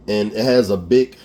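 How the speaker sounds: ordinary delivery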